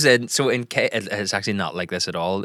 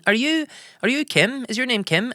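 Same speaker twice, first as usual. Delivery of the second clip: higher Belfast Filipino twang, lightly voiced; playful higher Belfast voice of a young server; Belfast Filipino girl; 13 semitones higher than usual. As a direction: imitate the KFC girl's Belfast lilt, pitched up. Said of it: "a real Belfast twang and a bit Filipino".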